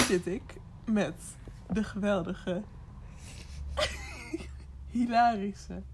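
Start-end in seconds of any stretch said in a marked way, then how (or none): 2.7-4.9 high breathy giggle. Real, not acted. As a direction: burst out giggling. Hosts laughing at intro.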